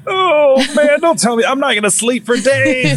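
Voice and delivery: mock scared plea. Begging his wife to stop the ghost talk, high mock fear. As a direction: beg her not to tell, half joking.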